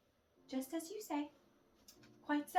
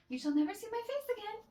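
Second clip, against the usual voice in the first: pitched high and louder, wounded pride; indignant parting vow; 9 semitones higher, 4 dB louder, faster than usual. Acted. Valere storming out on Marianne, high and loud. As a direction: fling it at her as you leave.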